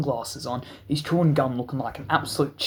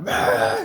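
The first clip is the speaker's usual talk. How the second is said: a single high sharp bark; imitated dog bark; 4 dB louder than usual. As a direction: bark like a furious police dog. Dog barking.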